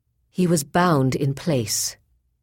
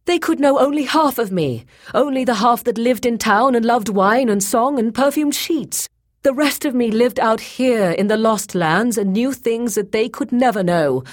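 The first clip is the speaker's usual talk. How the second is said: high, loud, impassioned outpouring; passionate; 9 semitones higher, 6 dB louder than usual. A narrator's reading. Boyce explaining his hidden half to Grey Alis, passionate. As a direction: pour out the confession fervently. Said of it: "he said passionately".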